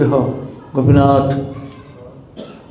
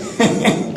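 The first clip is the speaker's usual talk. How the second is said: soft laugh; 7 semitones higher, 6 dB quieter than usual. Real, not acted. Teller laughs at the story.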